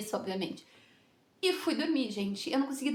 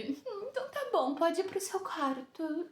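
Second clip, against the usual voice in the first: slow, higher put-on grudging voice; imitating sulky woman; 6 semitones higher, 4 dB quieter, slower than usual. Disappointed woman sending her off to her room, mock grudging. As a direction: mimic her reluctantly letting you go. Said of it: "ela fez aquela cara dela de".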